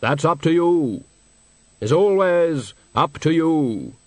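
slow, measured, otherworldly; patient Grim Reaper explaining; Death voice. Acted. Death reasoning with a dying swan, slow and grave.

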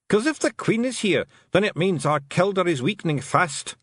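high, anxious Scottish clansman; worried Feegle. Acted. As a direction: explain the bad news in a high plaint. Feegle laments their weakening leader.

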